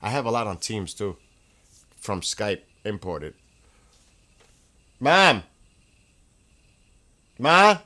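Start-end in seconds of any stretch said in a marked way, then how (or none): 5.0-7.8 loud, high call across the house; real shout to get her attention. Real, not acted. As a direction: yell for mom from another room. Calling out to mother.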